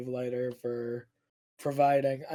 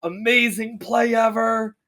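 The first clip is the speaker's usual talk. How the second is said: freaking out; 6 semitones higher, 7 dB louder than usual. Pretend. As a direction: gush like a stunned caster. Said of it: "I wanna hear freaking out".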